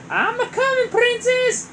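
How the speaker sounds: high, loud Italian-ish call; Mario voice. Acted. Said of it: "Mario called".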